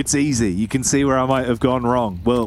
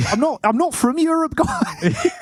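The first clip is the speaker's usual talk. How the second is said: broad Aussie twang, pitched up; Australian accent. Retold or imitated. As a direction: say it like a cheeky Aussie. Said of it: "I like saying that in the Australian accent".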